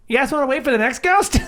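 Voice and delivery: gnome voice. Acted. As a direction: ask it like a busy gnome. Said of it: "your gnome isn't bad".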